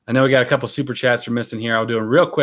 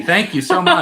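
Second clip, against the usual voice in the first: laughing thanks; 12 semitones higher, 4 dB louder than usual. Real, not acted. Funny gift, laughing thanks.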